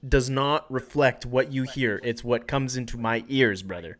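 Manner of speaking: scolding. Pretend. Mock quarrel over sound check.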